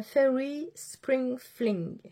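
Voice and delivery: put-on slow, deliberate English accent. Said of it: "Avec mon super bel accent anglais". (avec un accent anglais appuyé, lentement)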